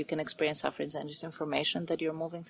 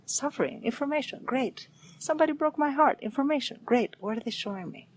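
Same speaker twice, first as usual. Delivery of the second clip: much higher, upbeat and lively, welcoming each blow; cheerful acceptance; 7 semitones higher than usual. Retold or imitated. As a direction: greet every hurt with a bright "great". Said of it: "I just say".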